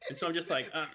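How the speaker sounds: normal talking voice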